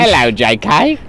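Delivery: lightly put-on Cockney, cheeky hello; a hint of a Guy Ritchie Cockney; mock Cockney greeting. Friends riffing on meeting J K Rowling, cheeky mock Cockney. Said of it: "I went full Guy Ritchie on with the accent".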